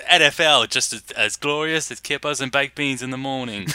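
hammed-up Suffolk English accent; mock Suffolk accent. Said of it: "that's my Suffolk accent".

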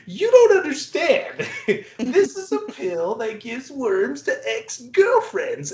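crazy scientist insisting. Retold or imitated. Crazy scientist re-pitching to corporate suits, high and giggling. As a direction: push the pitch up like a giggling lunatic. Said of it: "plays this crazy scientist".

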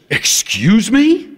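high, slow "excuse me"; stunned, stretched question; shocked administrator. Retold or imitated. Administrator taken aback.

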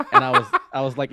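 loud fast laugh through the words. Real, not acted. Laughing, telling friend about the funeral questions. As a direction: laugh while getting to the punchline.

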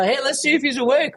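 high, hammed-up playful voice; goofing around. Pretending to mess with the mourners, goofing around. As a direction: tease them in a silly high pitch. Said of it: "I'd be goofing around".

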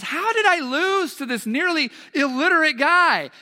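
high, loud retelling of bewildered disbelief; baffled protest. Retold or imitated. Asking the girls at the table how he lost, loudly baffled. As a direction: act out loud, high-pitched bafflement at losing. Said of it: "I was completely confused".

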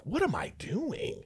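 slow, high whisper to himself; whispered self-doubt. Retold or imitated. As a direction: breathe it to yourself slowly. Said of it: "I think to myself".

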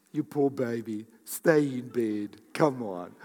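put-on coddle them like a poor baby; mock coddling. Acts out coddling the patient.

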